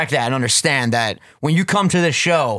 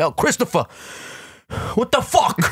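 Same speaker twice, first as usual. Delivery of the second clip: Tony Soprano impression; 9 semitones higher than usual. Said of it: "Tony Soprano-esque".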